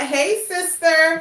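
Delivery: high bright call of hey sister to a stranger; a sister greeting a sister she just met, excited and high. Retold or imitated. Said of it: "It's like".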